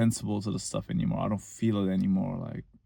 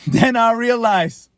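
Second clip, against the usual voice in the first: chuckling through the words, a bit higher and louder; amused laugh while telling; 11 semitones higher, 7 dB louder, slower than usual. Real, not acted. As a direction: laugh it out as the memory hits. Laughing at own story.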